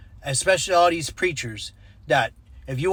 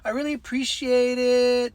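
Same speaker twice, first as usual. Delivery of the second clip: high-pitched apologetic gushing; embarrassed woman, imitated; 8 semitones higher than usual. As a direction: mimic a flustered woman's grateful thanks. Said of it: "she's laughing, and she said".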